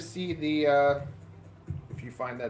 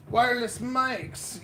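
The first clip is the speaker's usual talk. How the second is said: wireless mics, higher and slow with a falling finish; mock excited; 8 semitones higher, slower than usual. Shown as a demonstration. Streamer hyping new mics, mock excited. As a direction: draw out wireless like big news. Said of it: "Yeah, it's very exciting".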